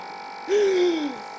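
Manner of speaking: another big staged inhale; high, strained intake; second gasp for air. Pretend. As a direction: gulp the air in. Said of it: "I have to get some air upon me".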